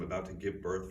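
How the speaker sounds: ordinary delivery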